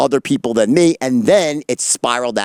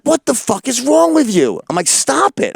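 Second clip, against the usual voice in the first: high-pitched incredulous protest; retold outrage; 11 semitones higher than usual. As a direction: scold the smoker, voice jumping high. Retold protest at crack smoking.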